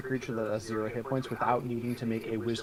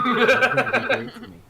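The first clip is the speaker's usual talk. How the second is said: loud, high pep talk; coaxing the dice; 13 semitones higher, 11 dB louder than usual. Real, not acted. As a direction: cheer on the dice.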